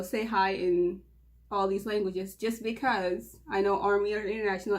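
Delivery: normal talking voice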